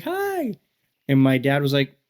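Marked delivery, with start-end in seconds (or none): 0.0-0.5 very high, sing-song hi; high mom greeting. Retold or imitated. Mom greets her son at the wedding, very high hi. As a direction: chirp a bright mom hello. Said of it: "my mom was like".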